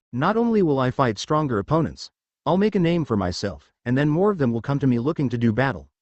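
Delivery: usual speaking voice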